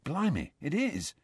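soft, stunned quiet; quietly. A narrator's reading. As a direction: say it under your breath. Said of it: "said Ron quietly".

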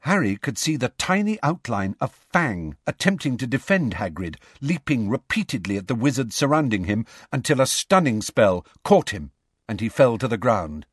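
plain reading voice

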